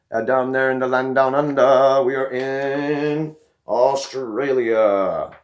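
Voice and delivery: loud, slow, stretched announcer boom; announcer-style hype call. Real, not acted. Vlogger shouting out Australian viewers, slow loud announcer call. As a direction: roll it out like a ring announcer.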